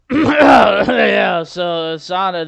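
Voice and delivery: shouted start, slow on Sonic; booming lecture opener. Acted. Mock professor opening the Sonic class, booming. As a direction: announce the topic like a loud professor.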